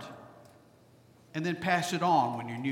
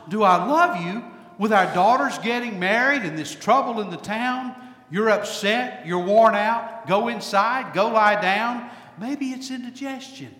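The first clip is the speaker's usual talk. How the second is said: a hint of a brisk, fussing wife, a bit higher; brusque Golda; 5 semitones higher, 5 dB louder than usual. Preacher lightly voicing Golda brushing off her husband. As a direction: brush him off, busy and practical. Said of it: "Golda looks at him and then responds".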